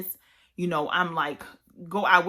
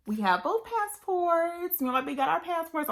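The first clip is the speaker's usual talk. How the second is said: bubbling over with real joy at the good news; real excitement, pitched well up; excited; 10 semitones higher than usual. Real, not acted. Finally got both passports.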